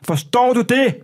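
loud, high-pitched imitated demand; retold angry demand. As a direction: demand an answer as the angry biker, short of full volume. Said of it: "Står og råber mig ind i ansigtet".

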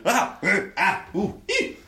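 high squeaky startled yelps; startled gnome yelps. Acted. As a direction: yelp like startled little gnomes. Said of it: "the sounds each of one of them made".

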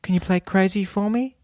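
high put-on woman's voice; dreamy woman. Host doing the woman caller, high. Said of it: "And she goes".

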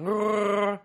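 high gurgling Wookiee growl; Wookiee gurgle. Retold or imitated. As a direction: gurgle it out like Chewbacca. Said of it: "Sorry, I'm not very good at the, at the Wookiee ... it's just a gurgling".

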